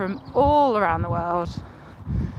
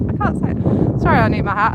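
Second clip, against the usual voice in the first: ordinary talk, nothing marked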